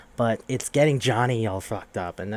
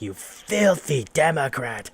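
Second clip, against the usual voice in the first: slow, louder, put-on contemptuous sneer; mock disgust; 4 semitones higher, 4 dB louder, slower than usual. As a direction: draw out the insult with theatrical scorn. Preppy leader insulting loser, retold.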